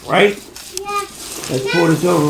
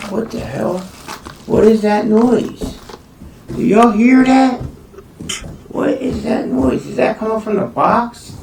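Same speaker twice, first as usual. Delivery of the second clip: puzzled alarm; 6 semitones higher, 4 dB louder than usual. Real, not acted. Strange noise in box.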